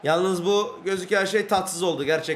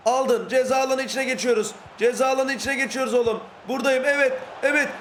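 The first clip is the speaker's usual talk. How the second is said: high, urgent running commentary; mounting excitement; 6 semitones higher than usual. Real, not acted. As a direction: build tension toward the shot. Attack building into the box. (giderek artan heyecanla)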